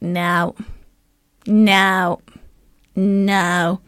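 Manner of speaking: imitated American classmate's refusals, spaced out; mock American accent, deliberate pauses between words; poor American accent. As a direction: say each no separately in a put-on American accent. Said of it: "I'm gonna do an American accent that's really poor".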